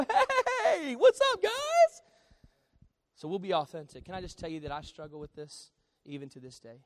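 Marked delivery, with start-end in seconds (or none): 0.0-2.0 loud, very high, drawn-out hello; fake cheerful greeting. Parent greeting church folks after yelling at kids, fake cheerful. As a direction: sing out an over-friendly greeting. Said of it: "I might yell at them in front of you".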